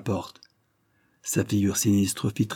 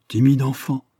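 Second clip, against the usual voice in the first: raised, louder voice with mild scorn; contemptuous; 5 semitones higher, 5 dB louder than usual. A narrator's reading. Delmare scorns the squeamish young soldier, contemptuous. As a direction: belittle the youngster loftily. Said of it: "reprit Delmare avec mépris".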